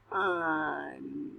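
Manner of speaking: W-un split into W and un; sounding out words. Shown as a demonstration. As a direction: sound out each piece. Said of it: "Say them".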